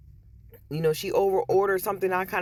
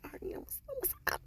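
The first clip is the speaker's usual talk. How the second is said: strained whisper; 15 dB quieter, much faster than usual. Retold or imitated. Nervous reply to customer.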